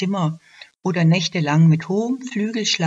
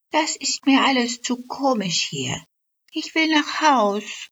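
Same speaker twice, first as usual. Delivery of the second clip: high, light fairy voice; a homesick elf, pitched well up; little elf; 8 semitones higher than usual. Acted. Elf telling her neighbour she's leaving, high and light. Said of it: "sagte die Elfe".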